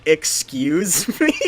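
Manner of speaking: loud, slow, high offended question; drawn-out indignant "excuse me" with a laugh. Retold or imitated. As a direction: act shocked and offended. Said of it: "he's like".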